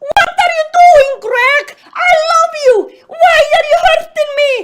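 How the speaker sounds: high, loud anguished scream. Acted. Jennifer to Greg after catching him cheating, screaming. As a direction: cry out in shrill betrayal. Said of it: "Jennifer screamed".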